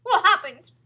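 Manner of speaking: high, loud frightened question; alarmed gasp. A narrator's reading. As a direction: demand it in a panic. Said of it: "gasped Missus Twit".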